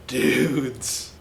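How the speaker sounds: usual speaking voice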